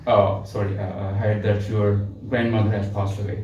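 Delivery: low, subdued condolence; sympathetic tone. Shown as a demonstration. Someone hearing a grandmother has died, subdued sympathy. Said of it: "So both are the same sentences".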